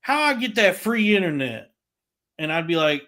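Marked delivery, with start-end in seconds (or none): 0.0-1.7 higher put-on voice of the caller; imitating the customer. Caller asking the salesman about free internet, higher put-on voice. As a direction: ask it in a raised, put-on caller voice. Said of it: "and then she's like".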